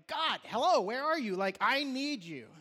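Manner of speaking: loud, high, demanding call; yelling at God. Acted. Believer calling on a seemingly absent God, yelling. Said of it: "yell at God".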